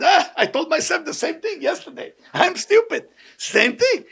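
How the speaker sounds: high-pitched, laughing agreement; cheerful self-mockery. Man cheerfully agreeing he is stupid, laughing self-mockery.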